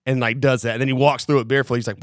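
ordinary talk, nothing marked

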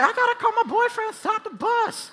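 screechy falsetto mock panic, very high; frantic girl. Girl desperate to call her boyfriend, frantic. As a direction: squeal it as a hammed-up hysterical girl. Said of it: "And this girl's like".

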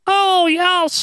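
very high, wailing funeral-host voice; bad Paul Bearer impression. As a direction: do a badly faked, high-pitched wrestling manager. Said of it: "that was a bad impression".

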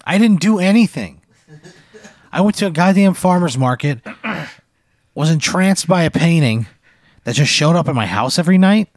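loud, high-pitched complaint; indignant protest. Real, not acted. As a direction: protest the unfair ending, voice pushed up high. Player protests his character's death.

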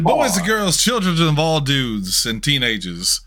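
booming circus ringmaster announcement. Acted. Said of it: "me trying my hardest to be energetic".

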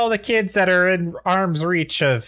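high strange alien voice; alien Gargalax. Acted. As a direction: play the alien dad greeting. Alien dad back on line.

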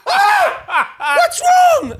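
very high scream; panicked scream. Retold or imitated. Wife panicking, voiced.

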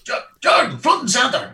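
high, loud parade-ground call. Retold or imitated. Sergeant major summoning Jordan, loud call. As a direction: bark it across the square.

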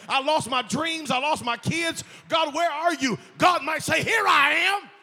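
loud, fervent sermon shouted to the church; full-force preaching. Acted.

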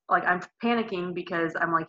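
ordinary talk, nothing marked

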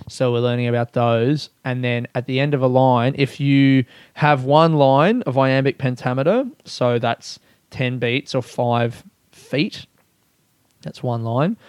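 usual speaking voice

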